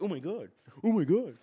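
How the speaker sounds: rising horrified shriek; panicked parent covering kids' ears. Pretend.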